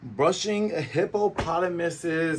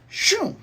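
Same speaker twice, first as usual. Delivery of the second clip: much higher "Shoo"; swish sound; 3 dB louder than usual. Retold or imitated. Karate chop flying through, high swish sound. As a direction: swish it like a blade.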